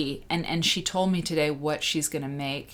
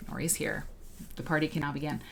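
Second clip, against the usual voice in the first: ordinary talk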